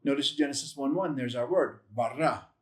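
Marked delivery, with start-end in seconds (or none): none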